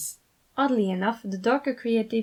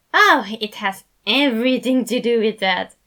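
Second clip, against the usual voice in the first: louder, cheerful and eager; eager reply; 2 semitones higher, 9 dB louder than usual. A narrator's reading. Remus eagerly answering the worried sides, louder. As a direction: relish the bad news. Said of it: "he replied eagerly".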